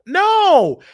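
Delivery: a high, loud flat refusal; emphatic no. Real, not acted. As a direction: shut the idea down hard. Rejecting the quoted idea.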